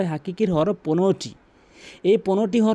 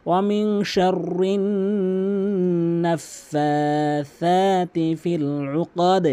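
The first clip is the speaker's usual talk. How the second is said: ওয়ামিন শাররি নাফাসাতি ফিল উকাদ drawn out with nasal ghunnah; slow recitation; much slower than usual. Shown as a demonstration. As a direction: recite it slowly with the nasal hum. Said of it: "গুন্না করে বলবেন". (ধীর তিলাওয়াত)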